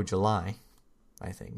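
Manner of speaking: normal talking voice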